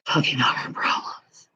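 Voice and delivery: hushed, breaking whisper close to crying; near-tears whisper. Real, not acted. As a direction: breathe it out, almost sobbing. Despair over feeling heart trouble.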